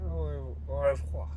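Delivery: "Au, au revoir" muttered softly, testing the French sound; half to yourself, quietly try "Au, au revoir" again; soft, unsure French "Au revoir"; quiet retry. Shown as a demonstration. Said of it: "it has to be French".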